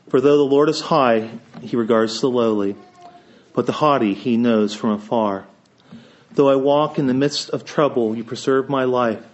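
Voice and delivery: ordinary delivery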